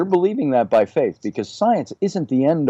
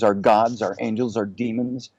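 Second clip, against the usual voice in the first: usual speaking voice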